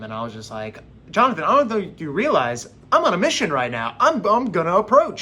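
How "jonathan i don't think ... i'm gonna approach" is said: higher, louder insistence; worked-up, insistent protest; emphatic retort. Acted. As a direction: push back, pitch jumping on the key words. Acted determined retort to Jonathan.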